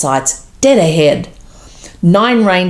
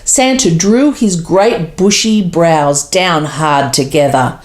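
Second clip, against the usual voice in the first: plain reading voice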